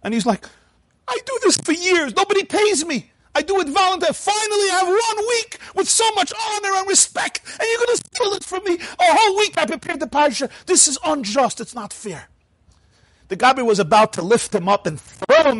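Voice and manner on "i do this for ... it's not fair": put-on high, tearful wailing old man; near sobbing. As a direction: plead through tears that it's unjust. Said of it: "the man is virtually sobbing".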